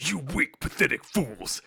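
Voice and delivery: villain voice. Retold or imitated. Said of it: "He's like".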